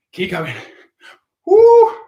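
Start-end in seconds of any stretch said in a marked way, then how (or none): 1.5-2.0 loud high whoop; excited whoop. Real, not acted. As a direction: cheer the class on with a whoop. Cheering the class on.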